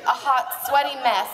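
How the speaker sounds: normal talking voice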